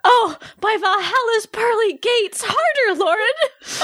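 high, breathless urging; hammed-up ecstatic Valkyrie; passionate cry. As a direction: cry out in rising pleasure. Acted passionate cries in scene.

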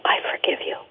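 soft whisper, barely voiced. Telling her mother she forgives her, in a whisper. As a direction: breathe it to her gently, no voice.

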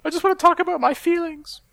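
high, far-off mock voice of a wife; mock girly voice. Mocking the wife in a high voice. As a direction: squeak it out like a caricature.

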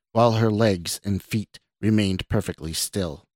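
ordinary delivery, nothing marked

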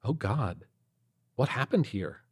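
plain reading voice

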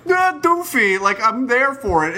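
high, goofy fan voice; doofy fan voice. Retold or imitated. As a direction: act like a dopey superfan. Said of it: "It are people who are like".

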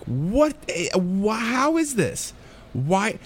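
high-pitched bewildered questioning; acted-out disbelief; baffled. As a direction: stammer it out, puzzled and high. Acting out childhood bewilderment.

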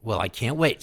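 normal talking voice

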